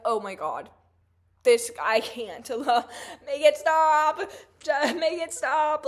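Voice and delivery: loud pleading, make it stop; exasperated plea. Retold or imitated. Reacting to her friend's condescending DMs, loud exasperated pleading. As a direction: beg for it to end, half laughing. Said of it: "I'm like".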